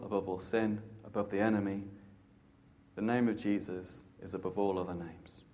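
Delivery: low, soft, awed declaration; hushed reverence. Real, not acted. Preacher humbly exalting Jesus before God, hushed and reverent.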